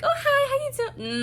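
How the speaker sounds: very high, squeaky fake-friendly hello; mock gushing greeting. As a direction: imitate someone being over-friendly, way up high. Mock gushing greeting she refuses to give.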